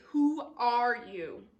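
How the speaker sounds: drawn out, word by word; slow confrontation. Shown as a demonstration. Beast gets right up in his face, slow and deliberate. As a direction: stretch each word of the question. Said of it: "You're gonna say".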